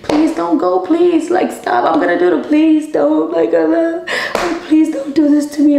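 high, pleading voice, begging him not to go; desperate pleading. Retold or imitated. Girlfriend begging her cheating boyfriend to stay, high pleading voice.

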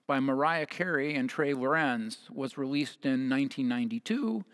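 ordinary delivery

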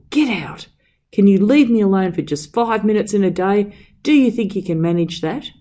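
high, loud angry order; screaming at him. A narrator's reading. Master ordering the servant out of the room, angry shout. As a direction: throw him out in a rage. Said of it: "screaming and shouting at him to get out".

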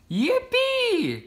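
very high squealing yippee; excited cry. A narrator's reading. A frog child hearing he gets a bike, very high excited cry. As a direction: shriek with delight. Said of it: "cried Froggy".

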